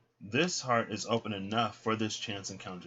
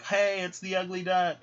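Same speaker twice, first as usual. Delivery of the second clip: mock greeting; 10 semitones higher, 5 dB louder than usual. Ducks greeting returning duck.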